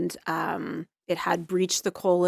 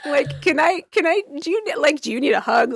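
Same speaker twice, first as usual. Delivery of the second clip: comforting; 7 semitones higher, 6 dB louder than usual. Retold or imitated. Patient comforting crying doctor.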